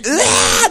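sudden high fake yelp as if tripping; hammed-up stumble shriek; mock scream. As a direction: yelp like you are falling on the stairs. Pretends to trip on the stairs.

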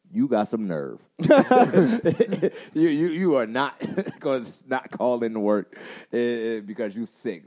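a co-teacher who can't believe the nerve, laughing; co-teacher mocking him for calling in sick, laughing disbelief; mock scolding co-teacher.

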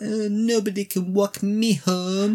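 slow, high put-on French accent; a light jab at a fake French accent; mock French accent. Host mimics the actress storming off, mock French accent. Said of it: "putting on a French accent".

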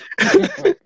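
shrill burst of laughter; high cackle. Real, not acted.

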